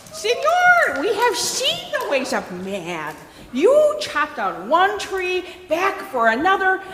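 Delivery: high, excited monkey voice; high-pitched monkey speech, swooping up and down; monkey character. Acted. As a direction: warn the sleeping man in a high monkey voice.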